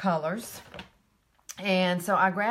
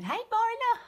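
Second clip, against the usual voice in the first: high sing-song hello; high greeting; 3 dB louder than usual. Real, not acted. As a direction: sing out the name. Greeting a viewer.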